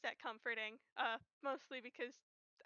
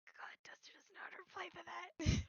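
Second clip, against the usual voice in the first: whispery, higher-pitched murmur; hushed reaction; 5 semitones higher, 5 dB louder than usual. Real, not acted. Player moved by the sad line.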